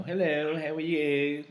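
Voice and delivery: affected cool-voice hello; cool phone voice. Retold or imitated. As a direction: mimic someone answering for important callers. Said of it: "with our cool voice".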